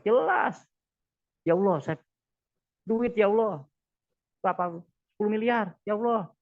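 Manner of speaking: clear loud request. Shown as a demonstration. Preacher demos a specific prayer to the congregation, loud and firm. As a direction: state exactly what you want, loudly. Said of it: "Makanya harus jelas".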